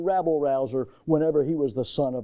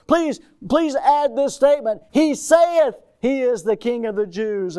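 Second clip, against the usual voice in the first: high, loud urgent begging; pleading priests; 6 semitones higher, 4 dB louder than usual. Acted. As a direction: press Pilate to change the sign. Chief priests begging Pilate.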